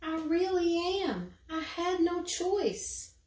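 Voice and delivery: high small pleading voice; little creature voice. Acted. The snatch-a-book explaining himself to Eliza, high and small. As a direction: plead like a tiny guilty creature.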